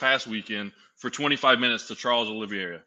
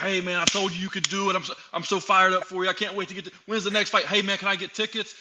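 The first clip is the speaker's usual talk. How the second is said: higher, eager mock-fan voice; mocking fair-weather fans; 6 semitones higher than usual. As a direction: play the suddenly supportive crowd, lightly. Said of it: "now all those people are like".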